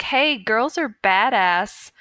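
ordinary delivery

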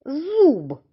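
Зуб with long З and У; drawn-out word. Shown as a demonstration. Stretches word for sound analysis.

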